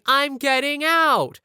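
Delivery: drawn-out, high, loud mock scream; imitated scream. Kyle screaming back at the teacher, imitated scream. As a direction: scream it defiantly, stretching the words. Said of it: "and Kyle screaming".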